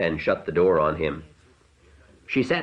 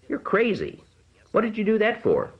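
high, startled voice; shocked. A narrator's reading. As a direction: cry it out in alarm. Alarmed after his plunge.

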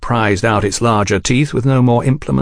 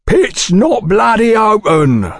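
slow, much higher, louder furious bellow; 11 semitones higher, 3 dB louder, slower than usual. A narrator's reading. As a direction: roar at the intruders. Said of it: "bellowed a fat man".

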